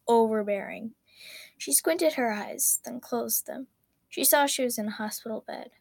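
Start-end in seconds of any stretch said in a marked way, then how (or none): none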